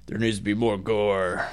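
lightly mocking gore-hungry viewer. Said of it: "people who have not read the book probably think".

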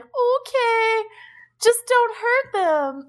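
put-on small, high, slow pleading boy; meek boy voice. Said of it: "reverts back to being, like, the meek boy version".

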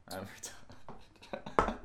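whisper. Real, not acted. Self-mocking aside after pun.

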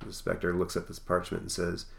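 usual speaking voice

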